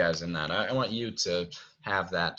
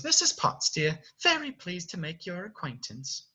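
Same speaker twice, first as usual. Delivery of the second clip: teapot lady; 7 semitones higher than usual. Acted. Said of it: "she says".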